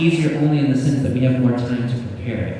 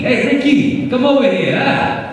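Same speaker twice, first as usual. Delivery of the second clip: much higher, loud call across the room; calling Ricky; 11 semitones higher, 4 dB louder than usual. Retold or imitated. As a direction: holler for Ricky like an uncle. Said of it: "little Ricky".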